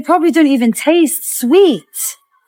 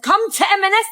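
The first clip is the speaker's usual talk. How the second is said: sarcastic mock quote; 6 semitones higher than usual. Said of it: "they said".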